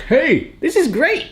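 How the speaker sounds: high, cartoonish voice for the corn; squeaky, delighted corn; happy corn voice. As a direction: give the corn a cheerful high voice. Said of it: "Our corn is like".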